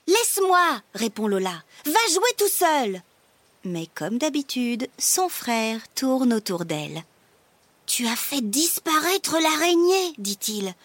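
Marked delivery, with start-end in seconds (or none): 0.1-3.0 higher-pitched, irritated brush-off; acted sibling irritation; annoyed big sister. Sister snaps at little brother. 7.9-10.1 high-pitched wonder; excited, higher child character; amazed little brother. Acted. Brother amazed at vanished spider.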